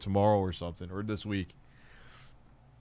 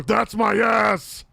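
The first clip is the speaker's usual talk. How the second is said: loud, high, drawn-out bellow; slow outraged shout; Tourette's Guy; 11 semitones higher, 7 dB louder, much slower than usual. Retold or imitated. Dad answering his son, bellowed impression. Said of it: "Tourette's guy".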